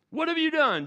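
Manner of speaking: sharp, high, loud demand. Acted. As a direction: demand it like an accusing ruler. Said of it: "Joseph demanded".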